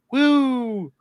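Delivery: long high-pitched "woo"; excited whoop. Real, not acted. Cheering the director John Woo's film, excited whoop. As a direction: let out a sustained, rising whoop.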